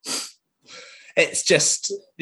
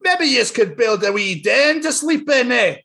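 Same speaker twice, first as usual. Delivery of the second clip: higher Scottish Daddy Pig; 8 semitones higher than usual. Acted. Reading Peppa book, higher Scottish Daddy Pig. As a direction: suggest it like a Scots dad. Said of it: "I'm gonna do it in my accent".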